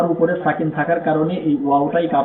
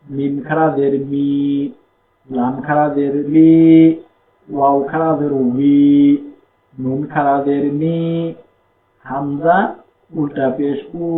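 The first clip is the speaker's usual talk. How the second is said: slow, chanted letter-by-letter drill; slow recitation drill; slower than usual. Shown as a demonstration. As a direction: chant each syllable slowly for students to repeat. (ধীরে সুর করে কায়দা পাঠ)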